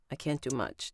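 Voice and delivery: "I can't do much" с падением в конце; опусти тон на "much", тише; downward inflection. Shown as a demonstration. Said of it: "Downward inflection".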